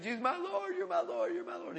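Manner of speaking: high, soft, tearful repetition; imitated crying. Freed young man confessing Jesus, crying. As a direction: cry it out high, over and over. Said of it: "He just cried and kept crying".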